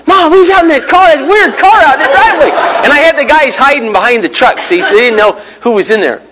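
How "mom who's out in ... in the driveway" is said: put-on high child voice asking mom; a kid at the window, pitched high; wondering kid, much higher; curious kids. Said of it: "the kids looking out the window".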